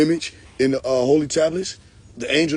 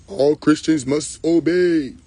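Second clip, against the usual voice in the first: slow, drawn-out proclamation; mock grand decree; much slower than usual. Mock prophet ordering followers to obey, slow and grand. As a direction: stretch it out like a pompous commandment.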